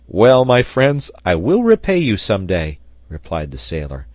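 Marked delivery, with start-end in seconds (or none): 0.1-2.8 loud, exuberant promise; heartfelt gratitude. A narrator's reading. Sailor thanking friends for their secret gift, exuberant. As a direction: declare it to all your friends, loudly.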